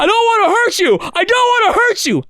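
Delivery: very high, loud scream, dragged out; panicked scream. Retold or imitated. Guest re-enacts pinning the phone thief, panicked scream. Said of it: "I just kept screaming".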